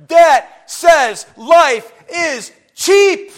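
preacher's full yell to the congregation. Real, not acted. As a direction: shout it so the back row really hears. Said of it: "I'm yelling it".

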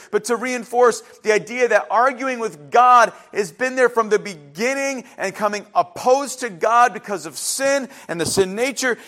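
ordinary delivery

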